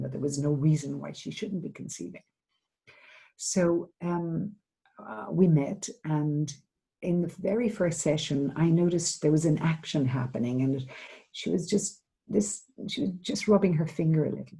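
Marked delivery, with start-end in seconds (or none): none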